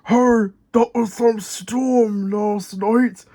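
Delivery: slow rumbling roller voice. Acted. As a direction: roll the words out slowly. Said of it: "rumbled Rolly".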